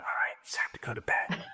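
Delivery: soft unvoiced whisper. Shown as a demonstration. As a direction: lean in and whisper it gently. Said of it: "I do it the whisper voice".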